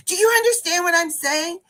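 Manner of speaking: high-pitched demanding question; pressing retold question, rising at the end; frustrated imitation. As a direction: imitate an exasperated student appealing for help. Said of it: "frustrated, and she's like this".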